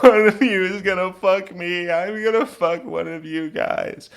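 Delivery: high, loud, whiny tearful demand; imitating a weepy drunk insisting; mock tearful. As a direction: whine it loudly as if through tears. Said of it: "she's wiping tears from her eyes".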